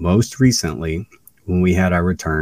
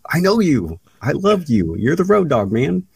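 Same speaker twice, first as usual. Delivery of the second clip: much higher, mock starstruck voice; gushing fan; 10 semitones higher than usual. Fan joking with Road Dogg backstage, gushing. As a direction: gush at your idol, playfully overdone. Gushing to his wrestling idol.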